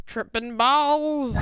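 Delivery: high, loud, slow drawn-out howl; loud slow exclaim; tripping balls exclaim. Real, not acted. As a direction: stretch it out like a punchline. Punchline shouted at friend's story.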